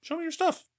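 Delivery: very high, excited "show me"; squeaky delighted fan voice. Retold or imitated. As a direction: falsetto eager musician. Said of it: "was like".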